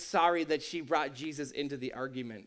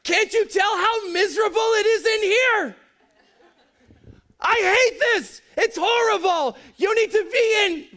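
hoge, luide geïrriteerde kreet naar Mary; frantic yelling Martha; 12 semitones higher, 6 dB louder than usual. Retold or imitated. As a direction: roep haar gefrustreerd vanuit de keuken, veel hoger en luider. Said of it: "she's like".

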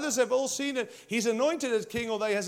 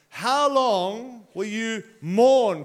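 slow, weighty voice of God asking "How long will you mourn?"; 4 dB louder, much slower than usual. Acted. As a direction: let God's question hang, very slowly. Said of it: "So then God says".

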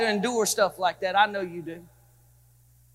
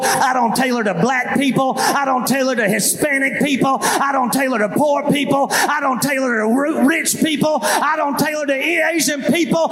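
building sermon cry; 4 semitones higher than usual. Acted. Pastor preaching to his congregation, building to a full cry. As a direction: preach it out, climbing each line.

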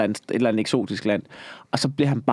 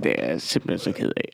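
1 deep mock apology; 12 semitones lower, 4 dB quieter than usual. Voicing an apologetic shark.

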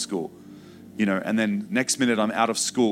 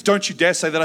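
higher, loud sharp denial; mother's angry denial; 7 semitones higher, 5 dB louder than usual. Retold or imitated. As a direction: forbid the words, frightened and angry. Mother refuses to hear it.

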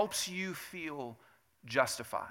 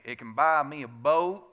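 lightly hammed-up country accent, louder; 6 dB louder than usual. Preacher quoting a country song for the congregation, country accent. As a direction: put on your best country twang. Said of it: "that's my best country accent".

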